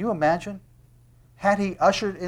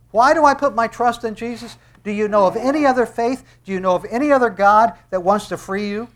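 high, loud rhetorical questions; impassioned challenge; 6 semitones higher, 7 dB louder than usual. Real, not acted. Preacher asking who else wants to free you, impassioned. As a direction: press the congregation with rising fervour.

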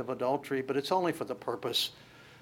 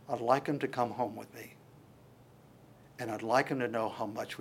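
soft, lower, intimate prayer; tender prayer; 4 semitones lower, 9 dB quieter than usual. Retold or imitated. Pastor voicing Jesus praying softly to his dad. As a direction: pray gently to a father.

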